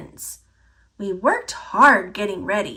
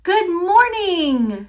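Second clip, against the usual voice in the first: slow, high, hearty shout; shouted greeting; 8 semitones higher, slower than usual. A narrator's reading. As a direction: boom it out to the whole hall. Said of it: "she shouted".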